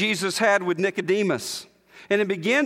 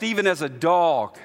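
ordinary delivery

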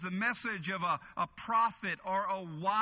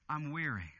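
weary murmur; 6 semitones lower, 3 dB quieter than usual. Acted. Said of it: "He's saying".

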